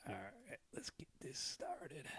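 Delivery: hushed whisper before the show. Real, not acted. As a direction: whisper the cue off-mic. Whispered cue before going live.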